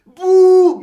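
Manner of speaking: huge high booing yell; loud drawn-out mock boo; crowd boo. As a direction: imitate a gym full of boos.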